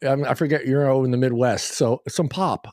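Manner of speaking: ordinary delivery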